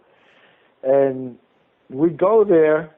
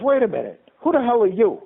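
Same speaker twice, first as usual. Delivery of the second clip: higher-pitched, taken-aback challenge; mock indignant question, pitch climbing; retold incredulous judge; 6 semitones higher than usual. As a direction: imitate a judge stopping someone short. Retells judge challenging him in court.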